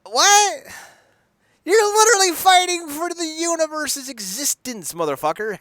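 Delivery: high, loud disbelief; outraged. Real, not acted. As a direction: yell in disbelief. Outraged at the movie.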